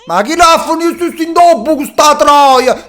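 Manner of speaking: mocking drawl. Real, not acted. Mocking game character loudly.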